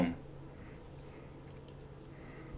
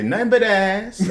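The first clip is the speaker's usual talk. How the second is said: cheeky reply; 10 semitones higher, slower than usual. Retold or imitated. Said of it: "That's what somebody's supposed to say".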